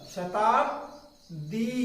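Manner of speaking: Shatabdi stretched out very slowly; drawn-out spelling. Shown as a demonstration. Teacher spelling a word to class, very slow and drawn out. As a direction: drag out Shatabdi for students writing. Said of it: "spelling it out slowly".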